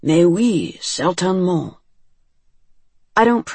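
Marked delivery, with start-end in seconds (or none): 0.0-1.8 lightly mocking French accent; silly French accent. A narrator's reading. Friend joking in a fake French accent, silly. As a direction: toss it off in a jokey French accent. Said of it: "in a silly imitation of a French accent".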